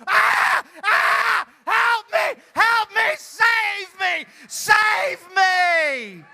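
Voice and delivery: very high, full-volume shrieks for help; terrified screaming. Shown as a demonstration. Host shows the volunteer what to do, screaming in terror. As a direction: scream each plea like a horror victim. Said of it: "and you go".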